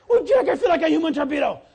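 fast, loud, laughing imitation; laughing boy voice. Boy joking to the pastor after skiing, laughing voice. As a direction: mimic the kid giggling.

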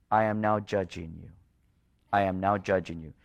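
low, measured voice of God; God's solemn judgment. Acted. God tells wandering people why, solemn judgment.

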